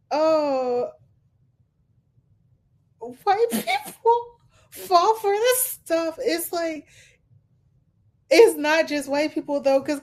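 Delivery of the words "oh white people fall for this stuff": higher, slower mock scoff, half laughing; mocking quote. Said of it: "He was like".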